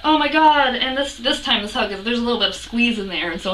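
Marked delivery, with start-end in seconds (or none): 0.0-0.7 loud, raised-pitch fangirl exclamation; mock freak-out, higher and louder. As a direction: burst out with retold excitement. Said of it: "I'm, like, freaking out".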